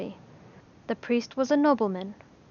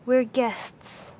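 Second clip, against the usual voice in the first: crisp; 2 semitones higher than usual. A narrator's reading. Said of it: "they said crisply".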